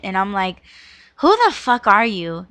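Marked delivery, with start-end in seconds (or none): 1.2-2.5 louder retold challenge, punched early; indignant disbelief. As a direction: act out an incredulous comeback. Retelling indignant reply to excuse.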